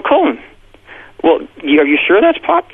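stunned disbelief. Retold or imitated. Father retells his stunned reply.

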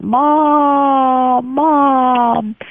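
put-on high child calling Mom; child crying out. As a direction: call after her like a little girl. Little sister running after mother.

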